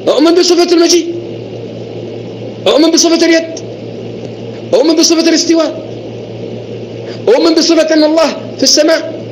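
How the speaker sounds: high mocking voice, parroting his creed; mocking the opponent. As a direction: mimic him in a high, sneering tone. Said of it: "إذا أنت تقول".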